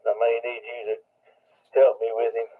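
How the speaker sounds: usual speaking voice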